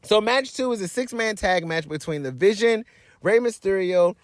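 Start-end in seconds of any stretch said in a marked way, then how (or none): none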